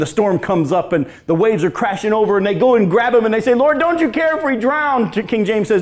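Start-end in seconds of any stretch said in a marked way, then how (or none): 3.5-5.1 panicked plea. Acted. Disciples crying out in storm.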